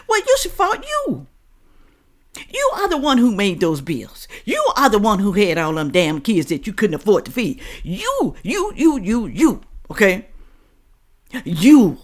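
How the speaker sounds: high, pointed finger-jabbing scold; heated accusing scold. Real, not acted. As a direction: blame them straight, hitting each you. Scolding viewers directly.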